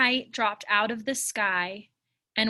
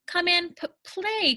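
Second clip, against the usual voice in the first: stuttering; 8 semitones higher than usual. Acted. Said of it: "my words get t- tangled".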